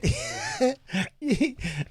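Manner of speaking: high wheezing laugh; crying laughter. Real, not acted. Host cracking up at his story, teary laugh. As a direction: lose it completely laughing.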